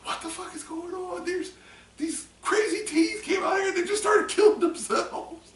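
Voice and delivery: high, loud, frantic shout; panicked movie yell. Retold or imitated. Movie character yelling about teens.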